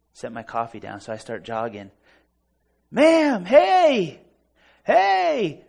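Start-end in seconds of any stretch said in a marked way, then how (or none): 2.9-5.6 high, loud call across a distance; shouting her down. Retold or imitated. Man jogging after a stranger in a parking lot, shouting. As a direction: shout to flag someone down. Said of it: "I'm shouting her down".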